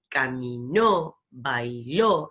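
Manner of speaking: slow caminó, bailó with strong, stretched NÓ and LÓ; final-syllable stress. Shown as a demonstration. Teacher showing words strong at the end, final stress on caminó, bailó. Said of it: "suena siempre fuerte al final".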